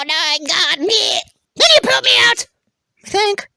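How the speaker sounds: very high, animated cartoon voice; excitable Tums character. Tums explaining itself to the carpet, high excitable voice. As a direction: play a peppy little talking tablet.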